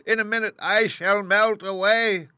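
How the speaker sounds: very high, loud, desperate witch; panicked witch. Acted. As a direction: shriek in terror of melting. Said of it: "she screamed".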